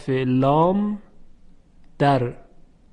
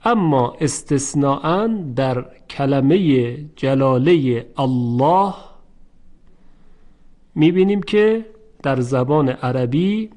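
normal talking voice

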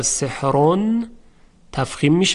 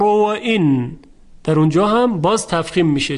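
ordinary talk

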